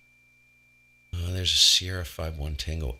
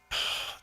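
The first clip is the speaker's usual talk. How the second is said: soft, tired sigh; weary sigh; 8 dB quieter than usual. Real, not acted. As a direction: let out a frustrated sigh. Frustrated by unconfirmed contact.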